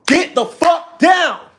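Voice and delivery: furious yell at the cat, high and slow; angry shout. Real, not acted. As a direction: bellow it, drawn out. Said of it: "Didn't want you guys to see me like that".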